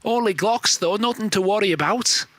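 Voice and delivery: mock English caster voice; put-on English accent. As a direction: call the match in a hammed-up English accent. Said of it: "three different English accents".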